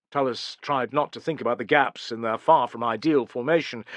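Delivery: plain reading voice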